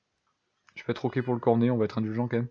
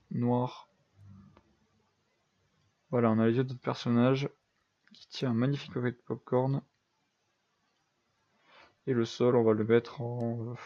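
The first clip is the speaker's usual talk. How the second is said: ordinary talk, nothing marked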